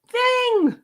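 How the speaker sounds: squealed high "Thing!". Shown as a demonstration. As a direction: yelp "Thing!" up high like a surprise. Sudden high exclamation of word.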